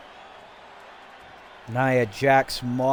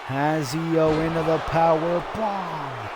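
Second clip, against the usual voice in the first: ordinary talk, nothing marked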